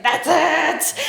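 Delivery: bursting out, fed up; snapping. Retold or imitated. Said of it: "I just snapped".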